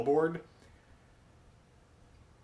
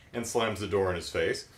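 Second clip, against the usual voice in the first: usual speaking voice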